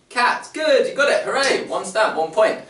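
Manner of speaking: excited praise. Acted. Praising children in class.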